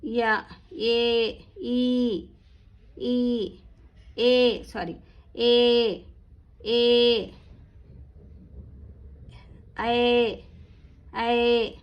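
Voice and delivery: long held vowels on an even pitch; vowel drill recitation. Shown as a demonstration. Teacher drilling vowel sounds for learners, drawn-out recitation. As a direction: hold each sound, pausing between.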